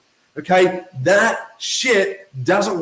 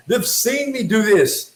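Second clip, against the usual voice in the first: ordinary delivery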